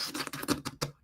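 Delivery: mouth-made sputtering bursts; quick, quiet run of raspberry spurts; imitated bathroom sputters. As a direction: sputter unvoiced fart noises with the lips. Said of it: "It always sputters in public".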